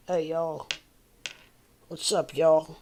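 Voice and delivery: lightly faked Southern greeting; mock-Southern twang; fake Southerner. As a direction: say hello with a hint of put-on Southern. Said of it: "I'm a fake Southerner".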